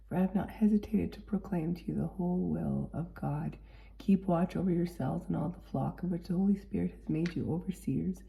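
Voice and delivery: usual speaking voice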